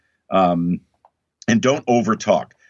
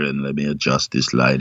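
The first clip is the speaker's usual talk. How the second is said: lowered, fussy cinematographer voice; DP impression; 7 semitones lower than usual. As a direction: grumble it like a picky director of photography. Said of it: "great impression of a D P".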